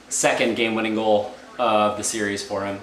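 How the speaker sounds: ordinary talk, nothing marked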